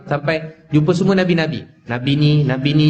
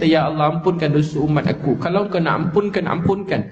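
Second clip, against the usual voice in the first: higher pleading preacher voice rising toward the end; preacher building to a higher-pitched plea; impassioned preaching; 4 semitones higher than usual. Acted. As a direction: beg for forgiveness on the congregation's behalf. Preaching climax, pleading for forgiveness.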